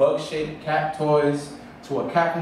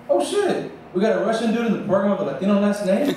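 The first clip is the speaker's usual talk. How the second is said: high, fast, laughing disbelief; surprised exclamation; 7 semitones higher than usual. Retold or imitated. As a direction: blurt it out in shocked amusement. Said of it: "my first reaction was like".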